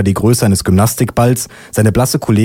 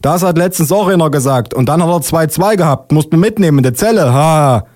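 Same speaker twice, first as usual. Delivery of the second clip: higher, amused policeman voice; acted jovial policeman; laughing policeman; 7 semitones higher than usual. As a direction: relish the anecdote, pitched up. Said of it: "lacht der Polizist".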